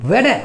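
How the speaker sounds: "ওয়াডা" with d replacing t; American-style "ওয়াডা", no r at the end; American pronunciation. Shown as a demonstration. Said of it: "American উচ্চারণ হচ্ছে টিটাকে ডি উচ্চারণ করে".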